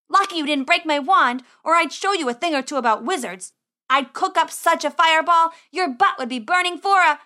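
loud, high-pitched blustering threats; indignant boy wizard. Acted. Boy wizard boasting revenge at slammed door, indignant. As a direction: bluster loudly at whoever threw you out.

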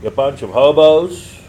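loud, high, drawn-out holler; yelled out. Retold or imitated. Boy yelling out car window at homeless men, loud high yell. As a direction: call it out loudly, pitched high and stretched. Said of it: "I, I yelled out the window".

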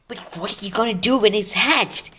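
high-pitched, urgent storybook question; concerned girl's question. Acted. Hermione's alarmed question about dragon.

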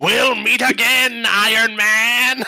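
high, loud, slow villain vow; slow theatrical villain menace. Retold or imitated. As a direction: ham up a cartoon villain's parting threat. Mock villain farewell.

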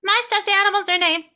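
put-on high, loud, sweet little girl listing toys; sweet kid voice. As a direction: chirp it out like a proud small child. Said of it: "then she's like".

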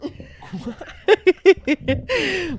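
bursting into laughter; a real high laugh. Real, not acted. Laughing at the joke. (risa a carcajadas)

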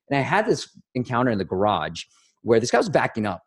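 ordinary delivery

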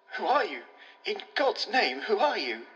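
panicked plea, voice catching; frenzied, choking. Acted. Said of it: "His frenzied, choking accents".

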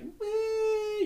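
high, drawn noncommittal squeak; doubtful eh. Retold or imitated. Storyteller showing reaction to a hard-to-read stranger, doubtful eh. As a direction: squeak it out as if unsure. Acts out being unsure.

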